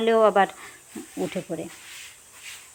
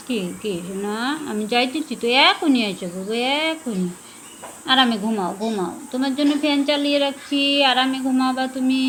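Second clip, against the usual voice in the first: soothing pet voice; 4 semitones higher, 5 dB louder than usual. Acted.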